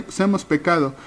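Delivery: ordinary talk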